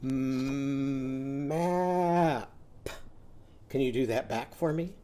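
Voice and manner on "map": "Map" with long hummed m and held vowel; sounded out. Shown as a demonstration. Teacher does the sounds in map, "Map" drawn out. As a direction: stretch "Map" slowly, popping the p last. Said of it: "let's do the sounds in map".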